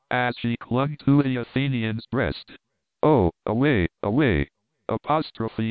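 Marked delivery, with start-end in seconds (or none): 3.0-4.5 loud groaning cry of terror; groaning plea. Acted. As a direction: moan it out, desperate to flee. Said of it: "groaned the slave".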